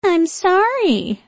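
put-on high, slow, whiny baby voice; mock the apology like a baby; high baby voice mockery. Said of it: "mimicking me in a high baby voice".

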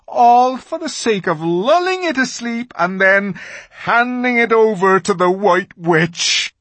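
high, loud, bitter fawn; self-loathing. A narrator's reading. Fawn confessing his plot to Lucy, anguished. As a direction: pour out the shameful confession.

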